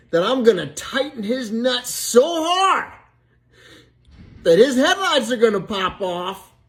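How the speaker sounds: acted fatherly threat, escalating; mad dad. Dad warning off little Johnny through Susie, mad. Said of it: "Her dad got all mad and says".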